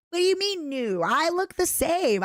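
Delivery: mock indignant. Real, not acted. Indignant at new model joke.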